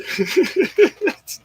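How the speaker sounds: high, breathless burst of laughter; laughing hard at the joke; real laugh. Real, not acted. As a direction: crack up, can't hold it in. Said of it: "I was dying".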